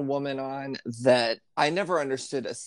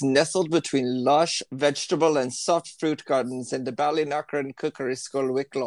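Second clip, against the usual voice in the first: put-on light lilting Irish accent reading a blurb. Friend slipping into an Irish accent while reading the school's description. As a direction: read it like the Irish TV cook, lilting. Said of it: "you just automatically, like, do the accent".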